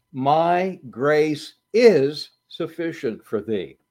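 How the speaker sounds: slow reading, is held and stressed. Shown as a demonstration. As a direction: lean on the word is. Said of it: "And the word "is".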